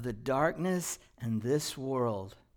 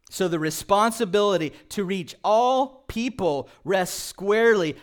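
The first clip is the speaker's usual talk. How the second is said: loud, higher preaching cadence; full-force preaching; 5 semitones higher, 8 dB louder than usual. Acted. Pastor urging the church to shine, preaching at full volume. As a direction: drive it home to the congregation.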